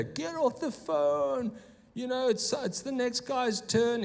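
high, mock yelling lieutenant; retold screaming. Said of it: "starts screaming in my ear".

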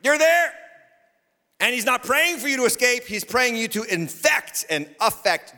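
high, ringing preacher's cry; full-force preaching. Real, not acted. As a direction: preach it high, stretching out the last phrase.

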